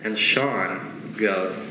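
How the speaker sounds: usual speaking voice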